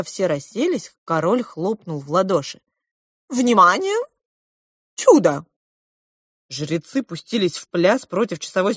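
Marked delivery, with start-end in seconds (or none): 3.3-5.5 showman's announcement. A narrator's reading. King announces a miracle to guests.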